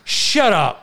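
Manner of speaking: annoyed retort. Retold or imitated. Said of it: "and I just wanna say".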